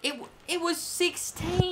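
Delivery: high, drawn-out protest; indignant, stretching the final word; exasperated correction. Real, not acted. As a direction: insist in a pained high voice. Disputing what was traded.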